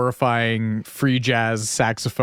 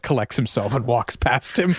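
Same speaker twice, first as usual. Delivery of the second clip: ordinary talk, nothing marked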